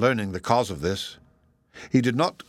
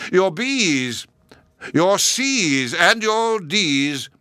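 much higher, louder, slow call to the crowd; shouted; 7 semitones higher, 5 dB louder, much slower than usual. A narrator's reading. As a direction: bellow it to the whole hall. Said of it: "addressed them in a loud voice".

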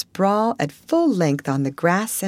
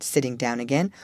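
ordinary delivery

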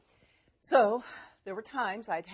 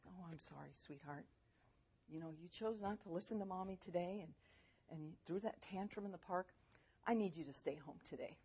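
gentle refusal; 2 semitones lower, 10 dB quieter than usual. Retold or imitated. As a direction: turn the child down softly and calmly. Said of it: "I did it in a way that still had dignity".

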